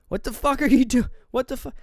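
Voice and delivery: high, loud, incredulous outburst; mock freak-out. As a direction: act out a panicked "what is happening" reaction. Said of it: "exactly what Justin does through this movie".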